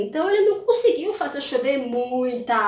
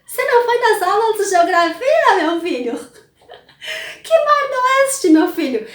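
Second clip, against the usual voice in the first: incredulous father; 4 semitones higher, 5 dB louder than usual. Retold or imitated. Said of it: "o pai ficou assim".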